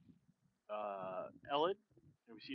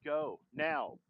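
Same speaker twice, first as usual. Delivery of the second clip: sharp louder order; acted command; 3 semitones higher, 6 dB louder than usual. Man ordering the daughter to get to the garage, loud command. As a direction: push them out the door.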